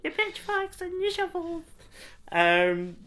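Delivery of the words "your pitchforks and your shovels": very high comic rallying cry; weird Little Britain voice. Retold or imitated. Friend riffing on the film's fight speech, high mock cry. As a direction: call up a peasant army in a silly high voice. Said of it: "weird little Britain voice".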